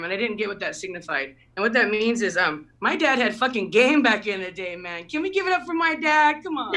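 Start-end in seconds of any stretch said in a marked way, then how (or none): 5.0-6.7 higher call to the crowd; rallying applause. Real, not acted. Comic asking the crowd to cheer for dad, an upbeat call. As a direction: get them cheering. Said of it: "asking applause".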